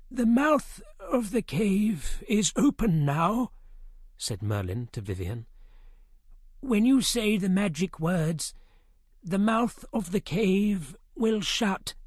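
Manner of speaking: a solemn magician explaining a spell; old wizard. A narrator's reading. Merlin explaining the cave to Vivien.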